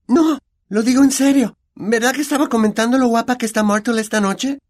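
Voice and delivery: high, loud insistence; insistent girl. Acted. Hermione insisting to Myrtle, high and emphatic.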